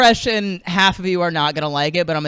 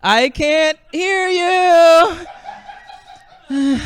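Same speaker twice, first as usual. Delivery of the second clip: deaf rock singer; 8 semitones higher, 4 dB louder, slower than usual. Acted. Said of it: "discovering that he's losing his hearing".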